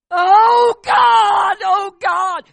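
shrieking high and loud to God; anguished outcry. Retold or imitated. Wife suddenly bursts out crying to God in the car, shrieking. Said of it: "she bursted out".

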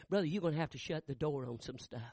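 ordinary talk, nothing marked

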